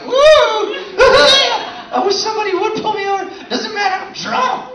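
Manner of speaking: put-on high, slow whooping drunk; mock drunk whoop. Comic drunk driver whooping out the window, high and slow.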